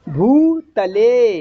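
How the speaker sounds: भूतले drawn out very slowly with a dental त; slow model of भूतले for the student; dental ta pronunciation. Shown as a demonstration. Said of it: "ए, देखो". (भूतले, धीरे और साफ़ दंत्य त)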